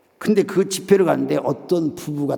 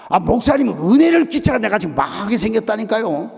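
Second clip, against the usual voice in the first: loud, high-pitched acted tirade; mock accusatory outburst; scolding, confronting; 7 semitones higher, 4 dB louder than usual. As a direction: take the pastor to task, imitating an aggrieved caller. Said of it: "야단을 치면서 막 따지는 거예요".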